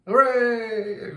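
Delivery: high, drawn-out hooray; celebratory whoop, stretched out; playful cheer. Pretend. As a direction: let out a long, high-pitched cheer. Cheering for a day of partying.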